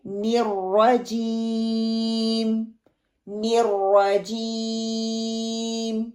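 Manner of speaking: slow chanted نِرْرَجِيمٌ; model recitation. Shown as a demonstration. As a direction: recite for the class to repeat. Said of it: "آپ بھی پڑھیں اور practice کریں". (نمونہ تلاوت)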